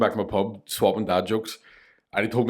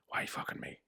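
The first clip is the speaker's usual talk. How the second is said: whispered, much softer; despairing whisper; 14 dB quieter than usual. Acted. Soaked man begging the Lord, in a whisper. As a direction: breathe it out in utter defeat.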